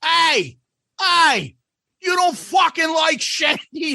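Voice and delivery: put-on high, drunken Aussie heckle; mock belligerent Aussie yell; belligerent yelling Australian. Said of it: "He started yelling at me".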